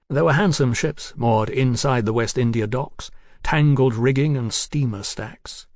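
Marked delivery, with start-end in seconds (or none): none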